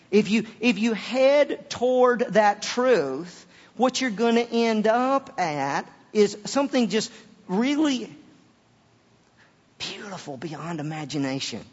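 high, loud sermon delivery; impassioned preaching. Real, not acted. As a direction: stretch the key words with conviction. Preaching at full force.

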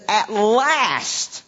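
mild exclamation on a long vowel; exclaiming. A narrator's reading. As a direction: read it out as a heartfelt exclamation. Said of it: "the man exclaimed".

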